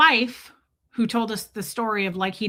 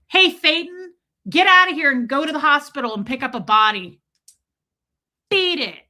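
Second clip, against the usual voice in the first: louder, higher call telling a ghost to leave; mock shooing shout; 4 semitones higher, 7 dB louder than usual. Ordering a ghost to go to the hospital, mock loud. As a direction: shoo it off like a pest. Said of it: "you're supposed to go".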